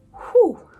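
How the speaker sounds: a high, loud whew of disbelief. Real, not acted. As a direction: blow out a big whew, as if overwhelmed. Exhales before describing graphic book.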